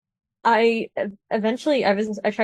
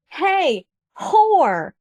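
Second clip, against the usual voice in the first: mock yell, higher pitched; retold yell; 7 semitones higher, 5 dB louder than usual. Angry shopper hollers across the parking lot, retold mock yell. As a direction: imitate someone hollering an insult. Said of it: "who she was yelling at".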